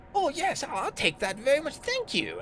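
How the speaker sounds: high, loud, greedy drow; gleeful high-pitched villain voice; drow's eager grab. Acted. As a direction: squeal it, then ease down on the thanks. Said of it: "kind of moves rapidly and goes".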